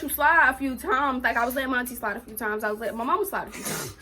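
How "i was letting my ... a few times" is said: usual speaking voice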